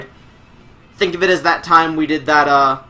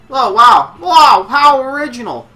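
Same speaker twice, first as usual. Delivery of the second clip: sarcastic wow; 10 semitones higher, 8 dB louder, slower than usual. Real, not acted. Mocking a predictable eight-man match.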